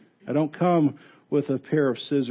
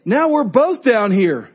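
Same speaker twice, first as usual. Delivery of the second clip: higher, louder, laughing disbelief; incredulous outburst; 7 semitones higher, 6 dB louder than usual. Retold or imitated. Man in the hole scolds his friend, laughing disbelief. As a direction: scoff at the friend who jumped in. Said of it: "And the guy says".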